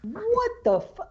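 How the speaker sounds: high, slow, drawn-out shock; stunned disbelief. Real, not acted. Host cut off mid-word in mock outrage. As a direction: caught off guard, voice jumping up high. Shocked reaction to a Turkish Loomis jab.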